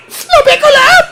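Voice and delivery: put-on impatient mother correcting a child; scolding mother. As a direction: play the strict mother drilling her kid. Mother drilling child with a ruler.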